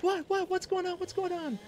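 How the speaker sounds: very high panicked voice, imitating the wife; acted falsetto alarm. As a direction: rush in fearing something's wrong. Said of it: "darting in like something's wrong".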